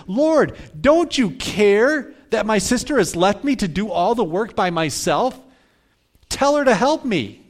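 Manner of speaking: acted exasperated shout at Jesus; yelling in frustration. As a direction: burst in and demand it in front of everyone. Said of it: "just yells it out in front of everyone".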